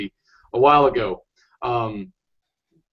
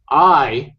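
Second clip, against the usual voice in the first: ordinary delivery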